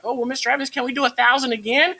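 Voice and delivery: high-pitched imitation of a student. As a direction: put on a high, questioning kid voice. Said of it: "your students are looking like".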